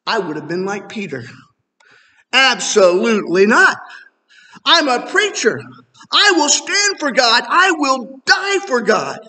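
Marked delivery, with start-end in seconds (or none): 2.3-9.2 loud, high, certain vow, slowing on die for God; bold vow. Retold or imitated. Preacher imitates his younger self boasting like Peter, loud bold vow. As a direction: proclaim it like Peter swearing loyalty.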